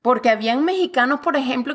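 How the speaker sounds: ordinary talk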